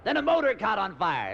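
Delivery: ordinary talk, nothing marked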